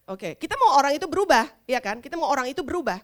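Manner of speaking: ordinary talk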